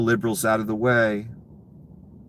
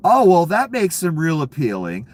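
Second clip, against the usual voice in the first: sarcastic; 8 semitones higher, 5 dB louder than usual. Real, not acted. Sarcastic rant.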